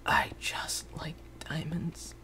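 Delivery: slow, breathy whisper; creepy whisper. Acted. Gamer insisting he is not insane, creepy slow whisper. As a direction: whisper it slowly like a confession.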